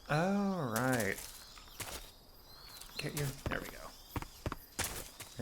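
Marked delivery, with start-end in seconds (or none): 3.0-5.4 whisper. Real, not acted. Quietly sneaking past in game.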